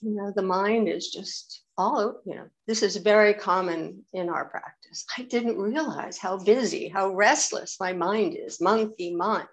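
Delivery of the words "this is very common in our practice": ordinary talk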